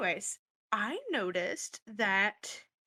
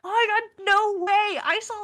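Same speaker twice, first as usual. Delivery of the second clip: higher, louder gushing; excited gush; 9 semitones higher, 7 dB louder than usual. Acted. Cheerleader Vicky gushes to Jojo, higher and louder. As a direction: squeal it out like big news.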